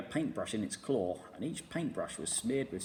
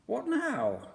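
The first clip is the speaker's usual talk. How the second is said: breathless bird; 12 semitones higher, 4 dB louder than usual. A narrator's reading. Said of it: "asked the roly-poly bird".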